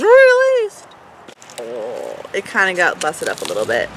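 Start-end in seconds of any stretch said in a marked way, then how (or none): 0.0-0.9 very high, drawn-out squeal; hammed-up high-pitched eagerness; mock impatient squeal. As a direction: squeal the word like an impatient fan. Impatiently awaiting the release, repeats word.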